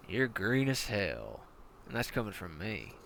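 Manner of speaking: commander quietly mocks the new recruit.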